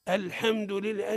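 الحمد with the "a" after ح bent toward "e", "al-hemdu"; wrong "hem" in الحمد, softly said; vowel tilted toward kasra. Shown as a demonstration. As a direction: say الحمد with a fronted, thinned vowel, leaning to i. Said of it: "ما هيش حاء، أنت جنحت من الفتحة نحو الكسر".

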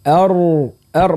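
thick, backed R in ar; heavy ar. Shown as a demonstration. Teacher showing the correct ar, ra heavy. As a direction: hold ar with the R drawn inward. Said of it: "صوت مفخم".

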